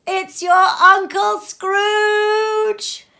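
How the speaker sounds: very high, loud, slow gleeful shriek; triumphant cry. Acted. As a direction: stretch out Uncle Scrooge as the winning answer. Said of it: "cried out".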